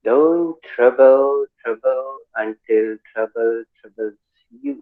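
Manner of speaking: very slow, drawn-out tongue twister; slow model reading. Shown as a demonstration. Teacher modelling a tongue twister for Renu to repeat, very slowly. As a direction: stretch out each word for learners to follow. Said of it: "पहले एक बार हमें सुन लीजिएगा".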